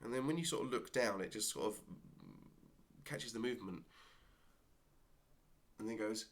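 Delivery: normal talking voice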